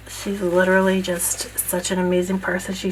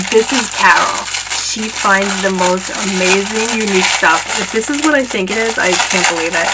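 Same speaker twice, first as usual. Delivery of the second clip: louder, gushing with excitement; thrilled; 6 dB louder than usual. Real, not acted. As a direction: rave about the gift in real delight. Thrilled by friend's gift.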